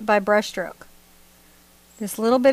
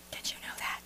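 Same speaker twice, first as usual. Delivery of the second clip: playful whispered question; mock secret whisper; 19 dB quieter than usual. Painter teasing viewers about feet, playful whisper.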